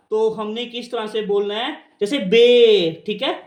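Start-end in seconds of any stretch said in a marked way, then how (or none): 2.2-3.0 "बे" loud, with a long drawn-out ay; long ay sound. Shown as a demonstration. Teacher showing how to say long ए, loud stretched "बे". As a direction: stretch out "बे" firmly. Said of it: "इसका लंबा उच्चारण जब आएगा".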